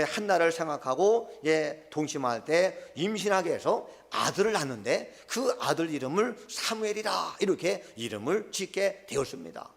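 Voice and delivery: ordinary talk, nothing marked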